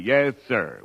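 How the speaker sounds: normal talking voice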